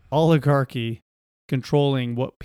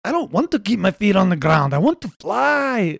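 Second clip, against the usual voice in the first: a lightly voiced game NPC, rising higher on the last words; NPC breaking script; 5 semitones higher, 4 dB louder than usual. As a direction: play a scripted game character who suddenly wants more.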